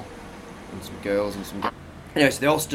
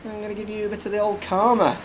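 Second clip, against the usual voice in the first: much higher, mock vengeful thought; scheming inner voice; 8 semitones higher than usual. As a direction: relish it like plotting payback. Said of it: "So I thought".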